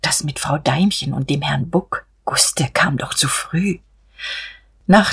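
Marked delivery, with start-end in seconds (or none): 0.0-3.8 timid, whispered confession, slowing on the last words; shy whisper. Acted. Mother admitting old gossip to her children, shy whisper. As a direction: hush it like a secret you shouldn't tell. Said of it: "erklärte sie schüchtern".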